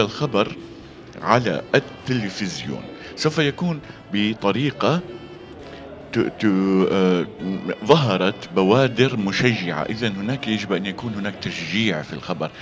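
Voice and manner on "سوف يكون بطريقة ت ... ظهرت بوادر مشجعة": normal talking voice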